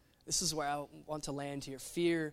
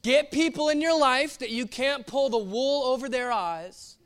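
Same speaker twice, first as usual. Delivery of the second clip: slow, deliberate preaching over music; earnest sermon exhortation; 6 semitones higher, 7 dB louder, slower than usual. Acted. Pastor urging the congregation, slow and earnest. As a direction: lean in and urge them slowly.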